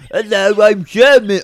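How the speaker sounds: loud, lightly mocked German accent; German guy. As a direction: announce yourself in a hammy German accent. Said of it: "let me play a German guy".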